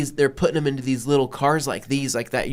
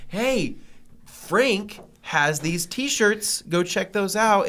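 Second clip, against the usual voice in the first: high, slow bright pitch; cheerful redirect; 6 semitones higher, much slower than usual. Retold or imitated. Steering the jet engine guy off to Frank, cheerful. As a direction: sell the T-shirts to get rid of him. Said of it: "like, say".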